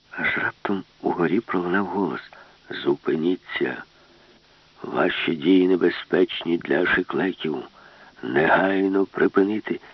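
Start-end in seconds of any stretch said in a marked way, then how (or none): 2.7-9.8 slow, lower commanding voice; machine voice. Acted. Ship's brain orders them to stop, slow low command. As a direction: speak as the cold ship brain, slow.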